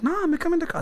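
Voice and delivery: high mock voice of the pushy stranger; high mimic of the older man's coaxing. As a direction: squeak the man's line to mock him. Imitating the man in the car.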